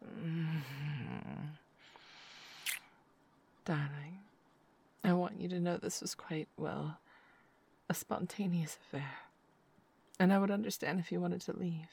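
hushed afterglow murmur, mostly whispered; intimate whisper. Acted. Lover settling her partner to sleep, hushed whisper.

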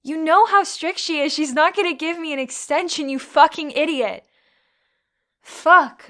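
yelling at the useless guy over the lost paper; furious yelling. Acted. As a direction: blow up at him, nearly screaming.

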